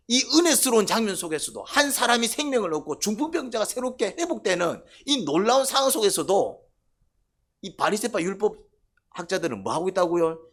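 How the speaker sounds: ordinary delivery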